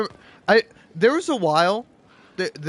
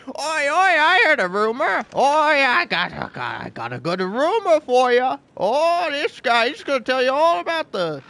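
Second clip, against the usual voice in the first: loud, high-pitched Cockney squawking; hammed-up Cockney, shrieked up high; Cockney crow; 8 semitones higher, 5 dB louder than usual. Gamer imagines a crow with a rumor, loud Cockney accent. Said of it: "like, a Cockney accent that is like".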